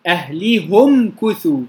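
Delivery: أهلهم كثوا read slowly with the ha as hu; Hamza's reading; damma reading. Shown as a demonstration. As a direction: say أهلهم as ahlihum. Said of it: "بضم الهاء".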